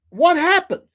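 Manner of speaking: high, loud incredulous question; mock shocked dad. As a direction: play a dad stunned by the damage. Acting out the dad seeing damage.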